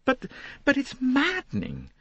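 very high, stammering protest; high, indignant woman's voice; exasperated lady. Acted. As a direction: protest in high-pitched outrage. Lynette protests to Poirot.

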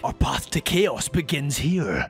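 low, slow villain line; lightly voiced villain; unhinged villain. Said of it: "That's my evil laugh".

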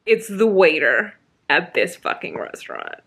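normal talking voice

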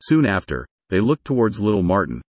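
plain reading voice

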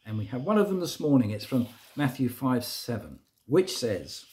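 ordinary talk, nothing marked

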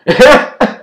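high burst of laughter; loud laugh. Real, not acted. Laughs at own joke.

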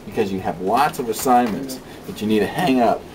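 usual speaking voice

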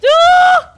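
high, loud sudden cry; startled scream. Real, not acted. Gamer screams high and loud at a hit.

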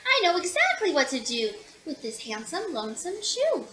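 ordinary delivery

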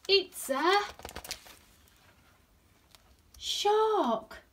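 high, loud shout with suspenseful break; terrified shout. A narrator's reading. Terrified fish spotting real danger, high shout. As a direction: hang before the last word, then shout high. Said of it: "shouted terrified Sprat".

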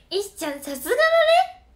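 put-on squealing praise to win the old man over; gushing praise. Chummy praise to farmer. (石ちゃんを甲高く大げさに褒めちぎる)

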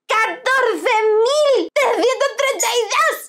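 high, loud, slow disbelief with a laugh; shocked outburst. Real, not acted. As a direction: react in laughing disbelief at the price.

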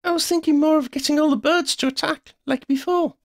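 high-pitched excited friend; high, called-out explanation; urgent explaining. A narrator's reading. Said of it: "Sophie explained".

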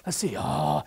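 low, quick mock pondering; mumbled hunt for an answer, sinking very low; thinking to oneself. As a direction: imitate someone puzzling it over under their breath. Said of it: "you're all trying to think".

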